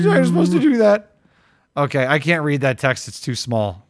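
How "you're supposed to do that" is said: high hammed-up protest; mock tantrum. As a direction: wail it in fake outrage.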